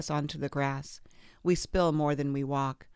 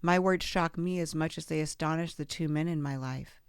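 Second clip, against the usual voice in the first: ordinary delivery, nothing marked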